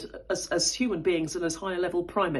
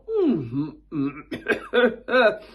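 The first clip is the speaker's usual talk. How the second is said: humming; 2 semitones higher, 6 dB louder than usual. Shown as a demonstration. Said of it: "fluctuating our voices".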